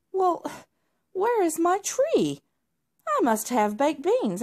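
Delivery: put-on high, loud childish demand; shouting tantrum. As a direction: stamp your foot like a spoilt boy. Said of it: "he shouted and stomped".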